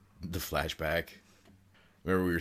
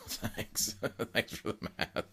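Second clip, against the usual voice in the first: laughing; 7 semitones higher, 3 dB quieter, slower than usual. Real, not acted. Laughs while thanking co-host.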